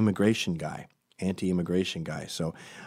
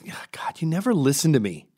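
imitated exasperated friend, higher pitched; really pissed; 7 semitones higher than usual. Friend scolding him for not listening, mock pissed. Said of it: "He was really pissed".

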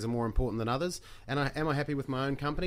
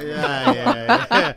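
laughing; 11 semitones higher, 13 dB louder, much slower than usual. Real, not acted. Laughs at South Auckland joke.

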